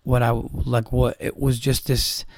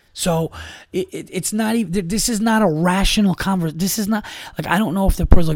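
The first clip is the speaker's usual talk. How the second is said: incredulous; 5 semitones higher than usual. Real, not acted. Baffled rant about ex-friend.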